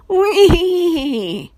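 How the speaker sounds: high neighing whinny like a real horse. A narrator's reading. Boy nudged by his friend, realistic horse whinny. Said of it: "Joey repeated".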